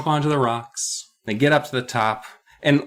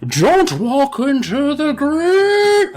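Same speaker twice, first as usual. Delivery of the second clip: put-on very slow, much higher and louder quavering old man; doddering old man; 13 semitones higher, 4 dB louder, much slower than usual. Old British man warning the kids, slow high quaver. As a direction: creak it out like an ancient Brit.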